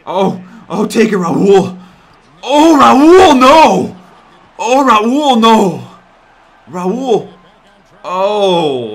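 loud, high, drawn-out groan of dismay; anguished yell. Real, not acted. Gamer yelling as his teammate blows the play, anguished. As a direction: yell in despair at the screen.

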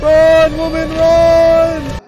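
loud, slow, high yell to run; drawn-out frantic shout. Real, not acted. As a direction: bellow at her to flee. Shouting at character.

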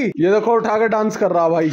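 normal talking voice

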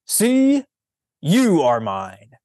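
high, loud call of "Sea", then a slow claim; clear loud call. Acted. Fausto calling out to the sea from his boat, loud and clear. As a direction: call it out across the water. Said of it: "in a clear voice".

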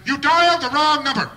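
phone grouch yelling back; irate stranger. Retold or imitated. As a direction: scold the caller.

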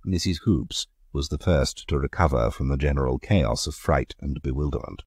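ordinary delivery, nothing marked

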